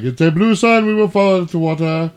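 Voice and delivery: Galaxy Quest alien voice; high, loud alien voice. Acted. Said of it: "I don't know why I sound like the aliens from Galaxy Quest".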